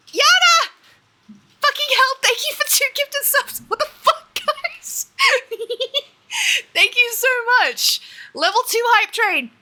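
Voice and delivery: high, loud, laughing disbelief; hoarse sleep deprived hype. Real, not acted. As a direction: squeal out stunned, laughing thanks. More gifted subs, hype train.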